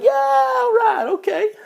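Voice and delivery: very high, slow, loud mock enthusiasm; fake agreement. Comic faking he understood the joke, high drawn-out. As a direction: play along as if you got the joke.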